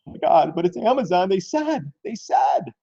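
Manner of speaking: high-pitched mock panic; mock scared. Streamer mimicking scared Amazon traders, mock panic. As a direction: imitate rattled investors in a high, whiny pitch. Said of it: "Some people are getting scared".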